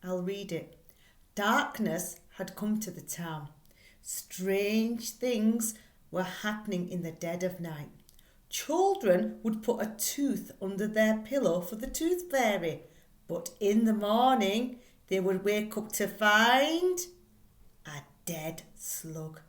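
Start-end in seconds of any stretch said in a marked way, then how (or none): 1.4-17.0 spooky story reading. Acted. Teacher reads the spooky blurb to her class, dramatically. 17.9-19.4 softer and slow, dropped low; hushed horror. Acted. Teacher reads the blurb to children, hushed and creepy.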